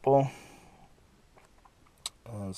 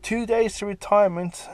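pitched way up for the joke; high dramatic reveal; 11 semitones higher, 7 dB louder than usual. A narrator's reading. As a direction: squeak the line out high. Dramatic comic narration.

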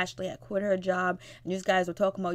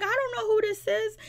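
very high mimicked whine; mock crying. Mother answers her daughter about the tattoo, mock crying. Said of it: "And she's crying".

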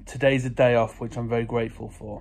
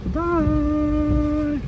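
high singsong bye-bye; singsong goodbye; 11 semitones higher, 4 dB louder, slower than usual. Real, not acted. Singsong farewell to daughter.

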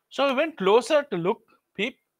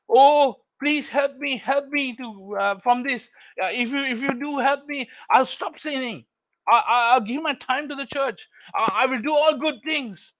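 desperate pleading; 5 semitones higher than usual. Acted. Jack begs God to save him, desperately pleading. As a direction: plead for your life, promising anything.

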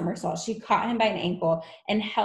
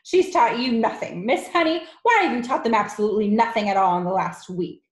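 loud, accusing triumph; gloating headmistress, raised voice; Trunchbull; 4 semitones higher, 7 dB louder, faster than usual. A narrator's reading. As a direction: crow loudly, then hurry into the accusation.

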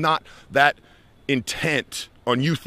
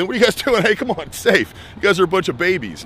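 higher, louder mock taunt; mock jeering; 6 semitones higher, 4 dB louder than usual. Tourist to people waiting at a crosswalk, mock jeering. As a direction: imitate someone goading the cautious crowd.

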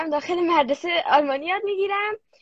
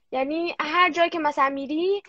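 usual speaking voice

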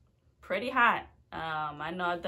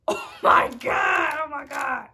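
scared shout; 8 semitones higher, 10 dB louder than usual. Real, not acted. Vlogger dreading the hot chip, scared shout. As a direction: cry out in real panic.

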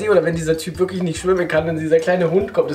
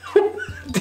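stifled giggles. Real, not acted. Laughing at silly video.

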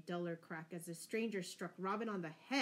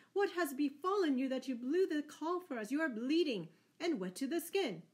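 high, raised questioning cry; concerned cry; 7 semitones higher, 5 dB louder than usual. A narrator's reading. Scarlet questions his bleeding master, high urgent cry. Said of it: "cried Scarlet".

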